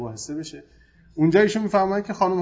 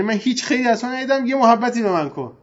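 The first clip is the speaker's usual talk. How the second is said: higher-pitched, mildly emotional mock plea; attention-seeking plea; 6 semitones higher, 3 dB louder than usual. Preacher imitates a wife wanting her husband's attention, mock emotional plea. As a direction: lightly act out an aggrieved appeal for affection. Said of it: "جوشش احساسات و برای جلب توجهه".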